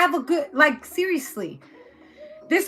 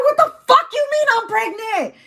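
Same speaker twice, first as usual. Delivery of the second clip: high, loud outraged wail; hammed-up hysterical shouting; meltdown; 9 semitones higher, 5 dB louder, slower than usual. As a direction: shriek in disbelief, building to a cry. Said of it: "Someone's having a meltdown".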